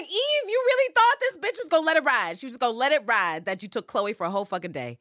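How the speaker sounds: high, loud mocking taunt at Eve; voiced Hélène taunting. Hélène gloats at Eve in the car, high mocking taunt. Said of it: "And it's like".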